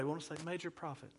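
hammed-up vulnerability voice, softly; sad, vulnerable. Shown as a demonstration. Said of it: "we wanna say it in a sad way".

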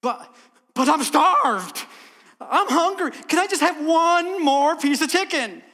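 loud, high, hammed-up plea; desperate pleading. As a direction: whine it out loud like a starving man.

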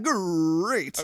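drawn-out growly "Great"; Tony the Tiger. Pretend. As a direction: stretch out "Great" like the cereal tiger. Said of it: "Tony the Tiger impression".

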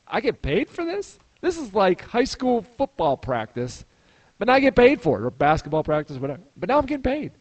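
high-pitched, incredulous delight; retold amazed disbelief. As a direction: act out can't-believe-your-luck amazement. Acts out his amazed realization.

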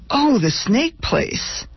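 high, slow, sudden recognition; light up as it clicks; perked up. A narrator's reading. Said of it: "I perked up considerably".

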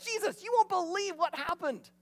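eager report to Jesus; excited disciples. Retold or imitated. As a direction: gush the news like a kid home from camp. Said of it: "Like, they're excited".

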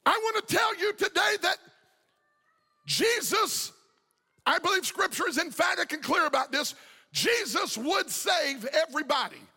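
full-force preaching. Acted. Pastor urging the congregation that God wants everyone saved, full-force preaching. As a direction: preach it out to the whole church.

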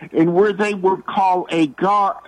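ordinary talk

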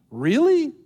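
incredulous. Real, not acted. Mocking a false teaching.

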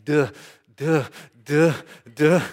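mock stuttering on one syllable, with gaps; stuck stammering. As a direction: act stuck on the first sound of a word. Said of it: "I can't get past the word Dot".

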